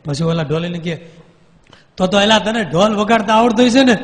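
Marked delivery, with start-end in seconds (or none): 2.0-3.9 raised, louder voice at the ghost; forceful sadhu voice; loud questioning swami. Retold or imitated. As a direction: call out to the spirit firmly. Swami questions possessed ghost loudly.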